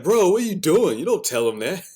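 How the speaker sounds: loud, high, disbelieving scold; incredulous shout. Retold or imitated. Scolding a friend for oversharing, loud disbelief. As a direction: call him out, half laughing. Scolding someone in retold scene.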